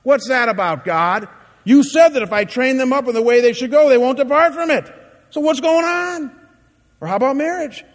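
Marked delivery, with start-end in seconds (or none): 0.0-6.3 louder, rising, indignant complaint at God; frustrated parent. Retold or imitated. Frustrated parent demanding answers from God, louder and higher. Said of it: "have you ever thought like this?".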